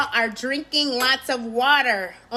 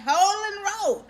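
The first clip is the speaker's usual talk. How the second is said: a higher grandma command; grandma's order; 7 semitones higher than usual. Retold or imitated. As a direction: bark it from the passenger seat.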